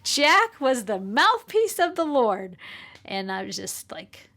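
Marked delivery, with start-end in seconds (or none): 0.0-2.6 high, loud, drawn-out proclamation; triumphant pronouncement. Retold or imitated. Friend praising the young son to his mother, triumphant pronouncement. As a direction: proclaim it slowly with big pitch swoops. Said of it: "she just said to me".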